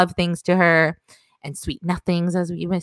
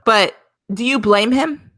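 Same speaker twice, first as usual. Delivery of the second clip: drawn-out, higher-pitched rhetorical question; pointed question; 5 semitones higher, slower than usual. Real, not acted. Co-host defending the lovestruck character, pointed question. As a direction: ask it slowly, lifting up at the end. Teasing question, later repeated plainly.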